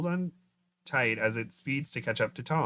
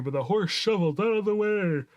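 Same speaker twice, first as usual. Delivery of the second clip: reading through a real yawn, pitch riding up; 7 semitones higher, 4 dB louder than usual. Real, not acted. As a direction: try to keep reading while a yawn takes over. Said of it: "I'm yawning so much".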